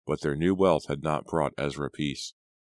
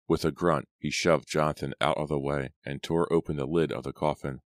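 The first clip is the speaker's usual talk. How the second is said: plain reading voice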